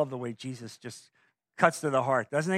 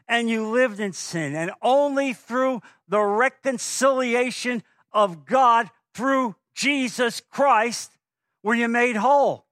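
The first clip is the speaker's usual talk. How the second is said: loud, high, slow preacher's build; preaching at full force; 7 semitones higher, 5 dB louder than usual. Acted. Pastor pressing the congregation on reconciliation, full-force preaching. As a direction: drive the word home to the congregation.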